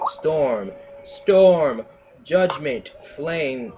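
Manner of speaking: high, loud battle cries; shouted spells. Acted. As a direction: yell the spell names.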